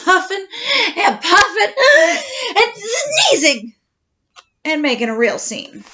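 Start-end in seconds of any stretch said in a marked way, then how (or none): 0.0-3.7 rising, laughing and shouting; hammed-up huffing and puffing. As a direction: build up, laughing, louder and higher. Wolf recounting his rampage.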